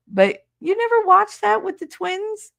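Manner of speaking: can't believe it, push never up; incredulous. Real, not acted. Disbelief at viewer who never watched the twins show. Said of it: "I never watched it".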